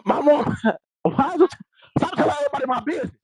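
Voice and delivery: high-pitched, laughing complaint; amused, high and breaking into laughs; laughing protest. Real, not acted. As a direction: protest through real laughter. Laughing about mom's interruption.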